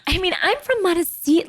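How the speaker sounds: high-pitched mock protest; exaggerated high-voiced impression; Cameron impression. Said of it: "she's like".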